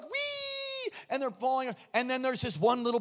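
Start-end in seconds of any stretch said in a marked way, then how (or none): 0.0-0.9 high squeal of delight; a child's gleeful squeal; playful high-pitched wee; kids squealing. Retold or imitated. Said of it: "And they're like".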